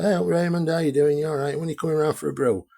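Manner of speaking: high, fast, falsely cheerful; sarcastic sing-song welcome; mock friendly greeting. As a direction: pretend all is well, breezy and high. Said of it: "Like normal".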